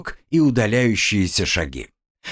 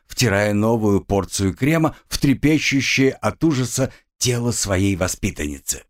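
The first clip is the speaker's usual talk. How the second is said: plain reading voice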